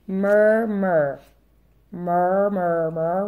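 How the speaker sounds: usual speaking voice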